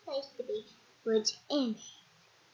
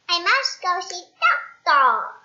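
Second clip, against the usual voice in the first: determined; 5 semitones higher, 9 dB louder than usual. Acted. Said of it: "Mister Bounce decides".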